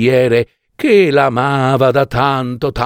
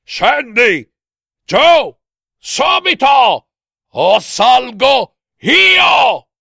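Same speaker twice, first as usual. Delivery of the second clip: high, drawn-out furious yell; full-lung shout; 13 semitones higher, 4 dB louder, much slower than usual. A narrator's reading. Husband orders wife down, brandishing a cutlass, shouting. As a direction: shout each order slowly at full breath. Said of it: "gridava a sua moglie con quanto fiato aveva in corpo".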